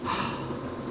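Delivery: quick breathy gasp of surprise; a soft excited intake of breath; little gasp. Acted. Little Bear gasps at the honey idea. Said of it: "Little Bear gasped".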